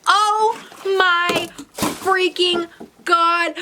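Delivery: slow, high-pitched awe; high, drawn-out real astonishment; stunned excitement. Real, not acted. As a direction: draw out every word in amazed delight. Stunned by gift reveal.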